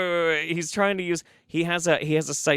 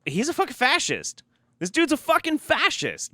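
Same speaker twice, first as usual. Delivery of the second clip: much higher, emphatic outburst; outraged declaration; 13 semitones higher than usual. Real, not acted. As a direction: fling the accusation out, pitch up. Finally calling him a fascist.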